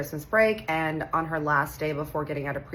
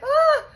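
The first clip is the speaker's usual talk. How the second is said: very high frightened shriek; startled scream; 7 dB louder than usual. Retold or imitated. Startled boy grabbed by an angry adult, high shriek. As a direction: squeal like a scared little boy.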